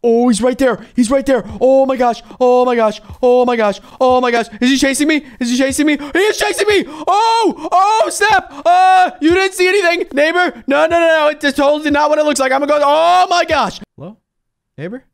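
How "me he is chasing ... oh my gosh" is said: panicked excuses. Real, not acted. Caught by neighbor, panicked excuses.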